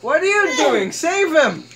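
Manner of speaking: high, mock-frantic demand; exasperated calling out. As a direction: plead with the useless lifeguard. Urging game lifeguard to rescue.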